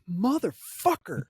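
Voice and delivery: drawn-out, high-pitched mock exasperation; imitating a friend's pissed-off reaction; retold pissed-off curse. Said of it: "like, pissed".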